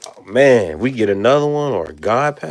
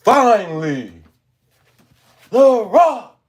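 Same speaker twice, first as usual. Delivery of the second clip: The Rock impression; 10 semitones higher, 5 dB louder, slower than usual. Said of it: "That's how my boy said".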